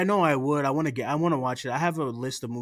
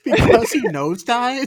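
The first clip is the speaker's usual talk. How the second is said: laughing disbelief; 12 semitones higher, 10 dB louder than usual. Real, not acted. Host challenging co-host's defence of an actor, laughing incredulously. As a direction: crack up in loud disbelief.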